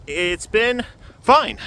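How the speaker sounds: high, slow, stretched-out fine; drawn-out reassurance. Real, not acted. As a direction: draw out the word fine, reassuringly. Repeats that things are fine.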